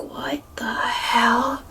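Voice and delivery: slow, drawn-out whisper; stunned whisper. Retold or imitated. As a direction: whisper it slowly in disbelief. Her stunned reaction to being interrupted.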